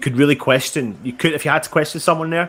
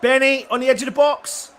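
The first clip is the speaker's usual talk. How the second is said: excited commentary; 10 semitones higher, 4 dB louder than usual. Real, not acted. Live chance building.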